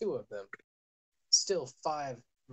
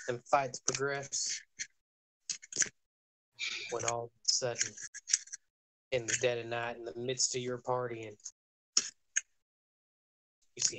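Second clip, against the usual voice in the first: put-on country accent. Game master narrating the trailer-park hoedown, country accent. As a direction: narrate the hoedown like a drawling hillbilly. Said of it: "I'm gonna stop talking in that countrified accent".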